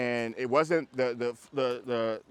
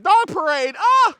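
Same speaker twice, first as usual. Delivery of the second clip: very high, loud mock kids' squeal; hammed-up child voice rising into a scream; excited children; 15 dB louder, slower than usual. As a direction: imitate kids shrieking at a parade. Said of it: "the children are like".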